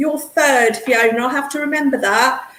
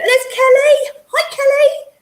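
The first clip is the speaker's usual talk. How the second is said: excited greeting; 12 semitones higher, 5 dB louder, slower than usual. Real, not acted. Greeting viewer joining stream.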